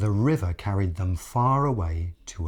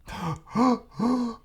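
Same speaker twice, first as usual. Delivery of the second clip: strained gasp. Acted. Crocodile tries again.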